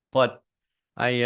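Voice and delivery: normal talking voice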